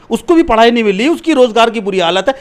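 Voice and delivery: real exasperated outburst, loud and insistent; heated argument. Real, not acted.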